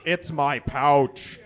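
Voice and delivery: usual speaking voice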